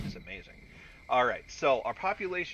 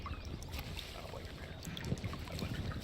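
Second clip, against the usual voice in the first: whispering; 7 dB quieter than usual. Shown as a demonstration. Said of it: "contrasted with plain take".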